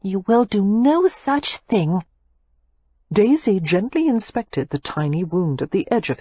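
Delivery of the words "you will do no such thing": playfully firm. A narrator's reading.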